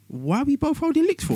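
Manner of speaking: squeaky retold friend's question; high-pitched mimic of friend. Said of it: "He goes".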